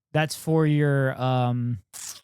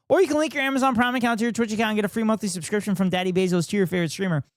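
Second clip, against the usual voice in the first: fast, higher ad-read patter; rapid ad read; 6 semitones higher, faster than usual. Acted. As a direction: rattle it off like a sponsor read. Reading the stock ad spiel.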